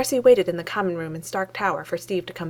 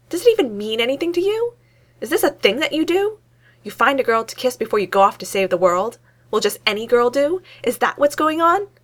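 louder and higher, rising indignation; worked up; 5 semitones higher, 6 dB louder than usual. Acted. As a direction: pour out the hurt accusations one after another. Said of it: "gotten herself pretty worked up".

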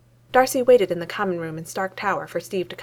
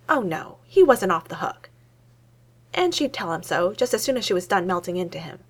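usual speaking voice

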